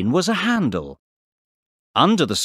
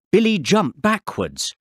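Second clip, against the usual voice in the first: plain reading voice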